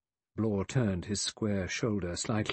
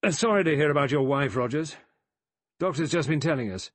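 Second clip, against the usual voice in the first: loud, higher-pitched burst of speech; sudden, explosive; 6 semitones higher, 6 dB louder than usual. A narrator's reading. General offers Rogers condolences about his wife, suddenly and explosively. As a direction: blurt it out explosively. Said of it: "spoke suddenly and explosively".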